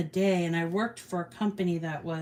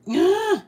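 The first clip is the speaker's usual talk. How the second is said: frustrated groan; 12 semitones higher, 7 dB louder than usual. Real, not acted. Can't find her craft pieces.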